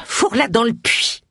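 high, acted breathless command; panting. A narrator's reading. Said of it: "haleta Missus Tripp". (haletante, d'une voix plus haute)

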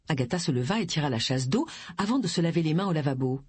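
ordinary delivery, nothing marked

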